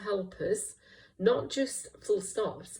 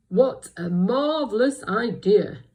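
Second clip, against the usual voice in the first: very loud, slow, grand exclamation; Bramwell; 3 semitones higher, 12 dB louder, slower than usual. Shown as a demonstration. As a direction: boom it out slowly with great excitement. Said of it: "in his deep, wise voice".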